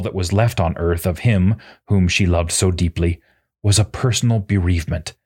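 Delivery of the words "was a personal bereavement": usual speaking voice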